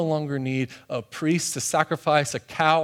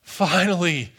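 exasperated "at last" with relief; imitating someone relieved and exasperated; 3 semitones higher, 4 dB louder than usual. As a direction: let it out as an exasperated sigh of relief. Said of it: "exasperated sigh of relief".

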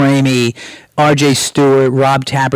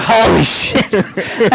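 drawn-out, higher-pitched amazement; retold amazement; 6 semitones higher, much slower than usual. Writer learning he gets paid not to write, amazed. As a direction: stretch it out as if stunned by luck.

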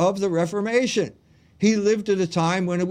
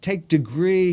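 usual speaking voice

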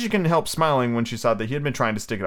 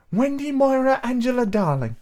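put-on satisfied girl voice. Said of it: "she replied with some satisfaction".

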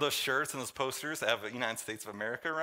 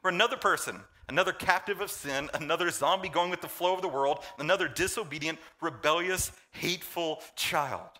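raised, forceful preaching cadence; 5 semitones higher than usual. Acted. Pastor preaching to the church, rising fervor. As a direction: drive the list home from the pulpit.

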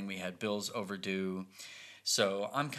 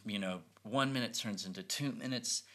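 usual speaking voice

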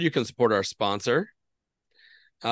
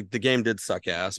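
ordinary talk, nothing marked

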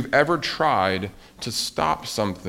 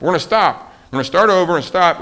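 louder, higher mock vow; determined resolve; 6 semitones higher, 10 dB louder, faster than usual. Teacher mimics Frog and Toad vowing to quit, determined. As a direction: declare it with emphatic resolve.

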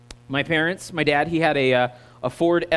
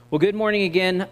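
ordinary delivery

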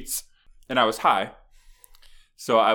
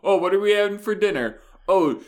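mock fan holler; 11 semitones higher, 6 dB louder than usual. Parodying her over dinner, high loud fan cheer. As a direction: holler it like a superfan.

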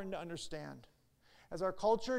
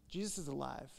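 softer, hushed restatement; quiet echo; 4 semitones lower, 6 dB quieter than usual. Shown as a demonstration. Pastor repeating to congregation, softer hushed echo. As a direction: let it settle, softly, almost to yourself. Repeated key line, echoed.

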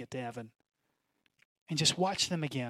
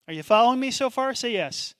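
rallying call; 7 semitones higher, 6 dB louder, faster than usual. Real, not acted. Pastor rallying the congregation.

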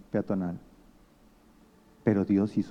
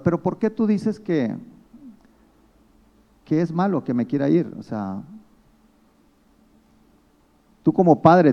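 raised, higher voice pressing the question; indignant challenge; 5 semitones higher, 6 dB louder than usual. Retold or imitated. Woman defending her choice to the interviewer, loud and indignant. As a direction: push back hard at the interviewer.

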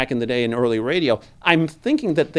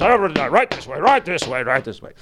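mailman line; 3 semitones higher, 4 dB louder than usual. Shown as a demonstration. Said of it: "heavy, heavy footed here".

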